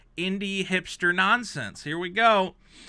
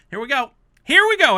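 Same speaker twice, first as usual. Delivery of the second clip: climbing higher and louder into a yell; building exasperated yell; 10 semitones higher, 11 dB louder, faster than usual. Real, not acted. Dreading the review, building to an exasperated yell. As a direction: brace yourself, then yell it.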